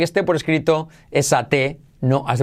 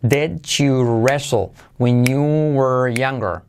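slow, drawn-out pronunciation model; slow model phrase; slower than usual. Shown as a demonstration. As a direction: stretch each word out for learners. Teacher models the phrase slowly. (pronunciación lenta y alargada)

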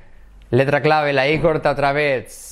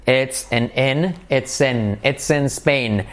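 ordinary delivery

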